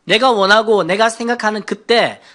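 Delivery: ordinary delivery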